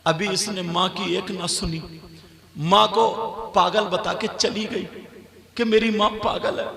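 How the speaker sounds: ordinary talk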